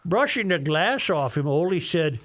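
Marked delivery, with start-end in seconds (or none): none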